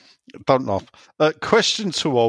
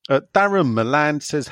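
ordinary talk, nothing marked

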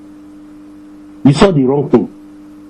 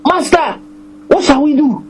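high, pleading cry of a scared servant; frightened servant's cry; 10 semitones higher than usual. Acted. Servant pleads with the man of God, high and frightened. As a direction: cry out to your master in panic.

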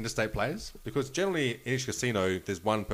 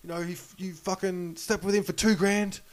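furious yelling; 10 semitones higher than usual. Retold or imitated. Wife confronting her husband's mistress in the casino, furious high yell. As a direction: scream it at the woman who slept with your husband. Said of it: "this lady's yelling at her going".

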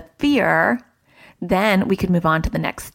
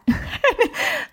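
light laugh. Real, not acted. Laughs at her own story.

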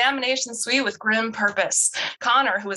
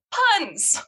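high squealing laugh; 13 semitones higher than usual. Real, not acted. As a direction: burst out laughing at the pun. Laughing at own pun.

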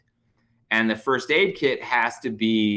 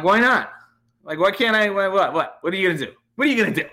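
high taunting challenge, climbing higher with each repeat; escalating defiance; 6 semitones higher, faster than usual. Retold or imitated. Driver daring a cop to act, escalating mock defiance. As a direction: build from indignant to squeaky, incredulous daring.

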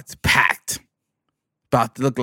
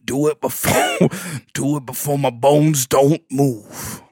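slow, whispery mock old mama through laughter; a laughing, hushed, morbid mama; mama whisper; 3 semitones higher, 5 dB louder than usual. Mama pushing her son, slow laughing whisper. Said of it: "Mama made it morbid".

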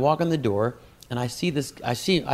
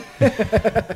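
laugh; 11 semitones higher, 5 dB louder than usual. Real, not acted. Laughing at his own story.

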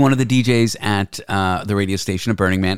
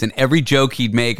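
usual speaking voice

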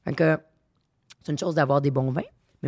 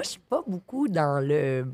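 ordinary talk

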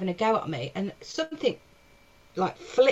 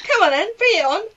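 high, loud, jokey bravado; mock defiant challenge; 8 semitones higher, 6 dB louder than usual. Comic back on stage daring the crowd, mock bravado. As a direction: goad an imaginary heckler in a put-on voice.

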